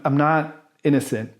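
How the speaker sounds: normal talking voice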